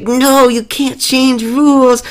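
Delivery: high, slow, loud whiny protest; hammed-up high complaint; imitating Markiplier. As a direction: wail it like a cheated player. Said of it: "And he's like".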